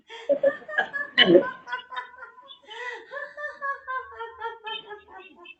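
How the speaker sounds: big, loud, high open belly laugh; laughter yoga demo for the class; laughter exercise. Shown as a demonstration. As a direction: burst into full hearty laughter. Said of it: "exercise कर लेते हैं हंसते हुए".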